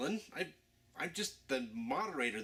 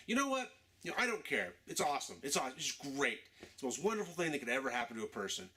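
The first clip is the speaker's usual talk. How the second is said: ordinary delivery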